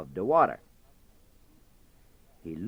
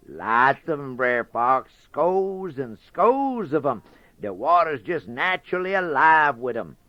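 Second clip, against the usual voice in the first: higher, louder bragging rabbit; boastful Brer Rabbit; 5 semitones higher, 8 dB louder than usual. Acted. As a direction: sell the tall tale proudly.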